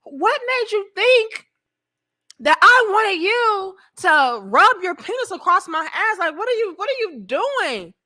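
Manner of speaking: high-pitched indignant questioning; mock outraged woman. Woman rebuffing the man behind her, mock outraged. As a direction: imitate a woman appalled at an advance.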